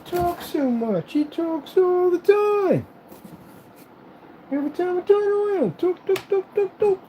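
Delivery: very high, slow, drawn-out imitation; mocking critic. Critic grumbling that he talks too much, mocking squeaky voice. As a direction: squeak out the complaint, stretching every word. Acting out the complainer.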